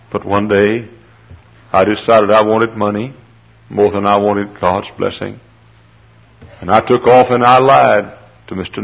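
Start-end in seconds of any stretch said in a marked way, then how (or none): none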